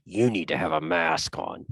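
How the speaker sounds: slow, pointed stranger demanding a mask; lightly voiced woman drawing out a mask order; stranger's mask demand. Retelling a stranger's parking lot remark, slow and pointed. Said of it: "she says this in the following manner".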